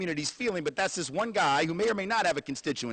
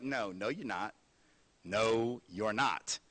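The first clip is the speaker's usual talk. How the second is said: mock dismissal; 7 semitones lower, 6 dB quieter, slower than usual. Congress voiced dismissively.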